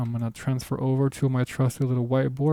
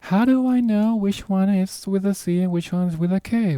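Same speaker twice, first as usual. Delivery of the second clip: higher-pitched imagined learner's question; voiced student question; 7 semitones higher than usual. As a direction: put on a higher voice for the puzzled viewer. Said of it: "question that's gonna pop in your head".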